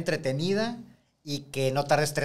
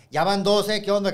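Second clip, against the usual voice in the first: sharp warning; 6 semitones higher, 5 dB louder than usual. Retold or imitated. Teacher warns student.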